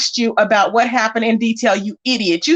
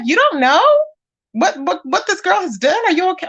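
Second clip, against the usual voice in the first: higher, incredulous you don't know; surprised disbelief; 6 semitones higher than usual. Retold or imitated. Streamer retelling her reaction to Nikki, higher incredulous surprise. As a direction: ask it pitched high, stunned. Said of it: "I was surprised".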